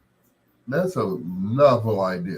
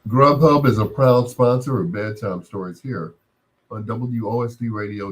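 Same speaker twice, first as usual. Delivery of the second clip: sponsor read; 2 semitones higher, 3 dB louder than usual. Acted. DJ reads sponsor message.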